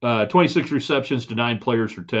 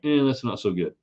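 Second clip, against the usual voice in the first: mock shrug. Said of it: "just kinda like".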